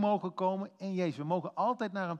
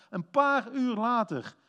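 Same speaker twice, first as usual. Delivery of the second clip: PAAR UUR LATER drawn out, high and loud; emphatic repetition; 6 semitones higher, 6 dB louder, slower than usual. Real, not acted. Preacher stresses how soon Peter failed, "paar uur later" emphatic. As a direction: hammer "paar uur later" slowly, raised in pitch and volume.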